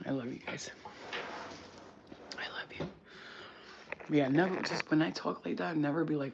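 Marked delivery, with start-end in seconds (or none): 0.1-2.9 whisper. Real, not acted. Whispered sign-off to viewers.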